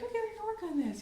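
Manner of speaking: chirpy upbeat announcement; cheery mom. Retold or imitated. Mom starting bedtime practice with son, bright sing-song. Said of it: "the other day I was like".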